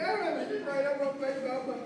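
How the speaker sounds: high childish whine, acted out; mock protest of a crying kid; wailing little boy. Crying child begging for mama.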